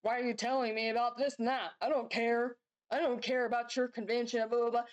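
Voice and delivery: lightly voiced dismissive retort; mock dismissive wife. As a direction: throw his indifference back, half mocking. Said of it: "Is she supposed to sit there and go".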